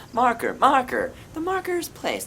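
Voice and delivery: high, soft imitation of the game voice; marker remix. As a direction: parody the marker announcer. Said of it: "Marker remix, baby".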